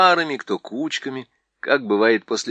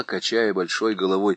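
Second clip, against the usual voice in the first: plain reading voice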